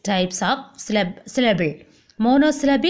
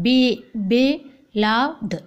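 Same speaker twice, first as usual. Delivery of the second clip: slow "beloved" with separated syllables; split into syllables; much slower than usual. Shown as a demonstration. Teacher showing trisyllable words, slow syllable by syllable. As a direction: break "beloved" apart after the "be".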